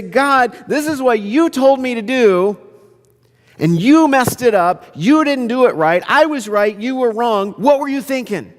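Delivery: high-pitched acted scolding; indignant telling-off. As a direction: take God to task, point by point. Imagined telling off of God.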